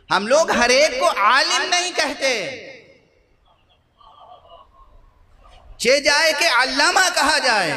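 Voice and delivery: high-pitched pulpit declamation; insistent sermon delivery, pitched high; emphatic preaching. Real, not acted. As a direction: declaim each phrase to the congregation. Preacher declaims on scholarly titles.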